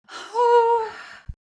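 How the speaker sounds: high, loud swoon; long high squeal of a sigh. Real, not acted. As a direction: let out a high, drawn-out fangirl sigh. Swooning over the hero's line.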